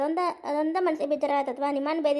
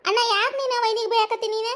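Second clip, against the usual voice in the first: higher-pitched objection to a brother; raised-pitch, upset challenge; pleading protest; 6 semitones higher than usual. Acted. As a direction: step in to defend your sister-in-law. Sister protests brother's scolding of sister-in-law.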